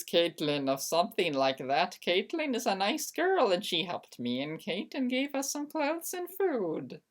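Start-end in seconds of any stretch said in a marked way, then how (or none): none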